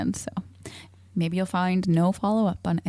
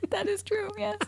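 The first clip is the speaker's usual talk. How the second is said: high, teary laughter through the words; real laughter spilling over the words; laughing to tears; 5 dB quieter than usual. Real, not acted. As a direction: laugh until it almost cries.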